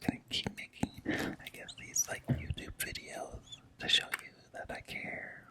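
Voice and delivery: breathy half-voiced whisper to himself; a soft private whisper; whispered aside. Real, not acted. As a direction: murmur it almost under your breath, just to yourself. Mumbled aside to the camera.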